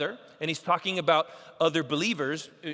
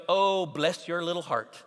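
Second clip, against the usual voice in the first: sugary Southern put-down, lightly done; mock-sweet Southern pity; Southern condescension. Preacher mimics a condescending Southern lady, lightly drawled. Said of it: "that's a Southern way of being very condescending".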